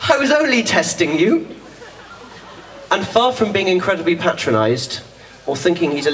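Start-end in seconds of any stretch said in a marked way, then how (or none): 0.1-1.5 much higher, triumphant burst with a laugh; spring the surprise like a delighted prankster; hammed-up cheery ta-da; gleeful reveal. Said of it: "and he says".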